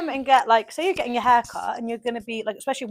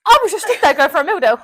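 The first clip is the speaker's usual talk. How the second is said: laughing; 6 semitones higher, 6 dB louder, faster than usual. Real, not acted. Laughing indignant retelling.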